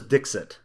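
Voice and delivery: "Dixit" said DIX-it, short second vowel; believed pronunciation. Shown as a demonstration. Co-host offering own version, "Dixit" as DIX-it. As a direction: say "Dixit" as DIX-sit, not seet. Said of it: "I thought it was".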